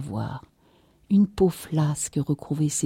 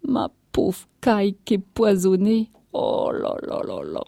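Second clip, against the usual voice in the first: weeping lament; 4 semitones higher than usual.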